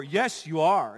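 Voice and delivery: firm, punchy comeback; bold contradiction. Retold or imitated. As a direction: contradict them boldly. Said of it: "She's bold and just declares".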